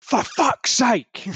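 high, drawn-out frustrated outburst; exasperated cursing. Acted. Game master as boat pilot whose motor was hit, exasperated outburst. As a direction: curse slowly in total exasperation. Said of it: "And Hamish just goes".

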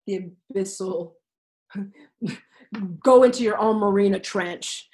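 normal talking voice

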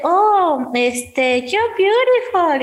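high, slow, drawn-out compliment; flattering idol. Retold or imitated. Idol flattering a blushing fan, high and slow. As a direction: croon the compliment. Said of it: "Y me dice".

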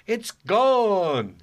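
high, loud mock child; delighted kid. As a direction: play the son thrilled his itch is gone. Son's delighted answer voiced.

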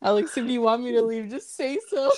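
high, fast indignant snap; mock offended outburst. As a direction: pretend to be hurt and huffy.

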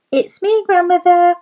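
put-on high, loud little girl call; a bright child calling at the door. A narrator's reading.

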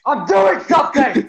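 loud interrupted holler; shouting back. Real, not acted. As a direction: yell back to another room. Yelling back, interrupted while recording.

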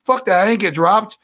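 high, loud indignant outburst; retold indignant protest; mock angry denial. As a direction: snap back in denial.

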